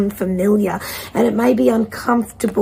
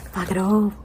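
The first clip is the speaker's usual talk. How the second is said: card's message; 7 dB quieter than usual. Acted. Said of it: "This one is going to be yelling at you".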